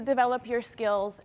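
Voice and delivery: ordinary delivery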